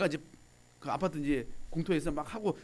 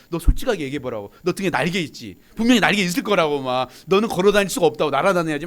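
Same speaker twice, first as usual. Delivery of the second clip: gushing praise; 9 dB louder than usual. Retold or imitated. Gushing praise to son's girlfriend.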